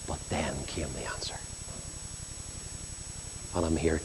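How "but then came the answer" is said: whispered, low and soft; hushed whisper. Real, not acted. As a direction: breathe the answer out like a secret. Preacher's hushed climax of testimony.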